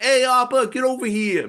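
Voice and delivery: high, loud exaggerated New Yorkie accent; mocking Yat accent. Uncle mocking the speaker's Yat accent, loud exaggerated New Orleans accent. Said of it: "making fun of me".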